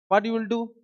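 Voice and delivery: ordinary delivery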